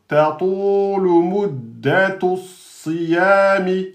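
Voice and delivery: "Tatulu muddatu siyami" very slowly, each syllable shaped; slow recitation. Shown as a demonstration. Teacher recites the phrase to the class. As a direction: recite slowly for students to follow. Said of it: "reading the verse slowly".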